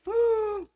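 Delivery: a sharp, high gasp of delight. Real, not acted. Host spotting the little pigs, gasping in delight. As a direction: catch your breath at something adorable.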